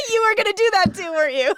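laughing through the words, high and loud. Real, not acted. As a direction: burst out laughing mid-sentence. Laughing at friend's joke.

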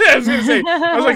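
talking through a high laugh; can barely get the words out for laughing. Real, not acted. Friend cracks up reacting to a joke, laughing through the words.

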